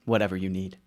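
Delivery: normal talking voice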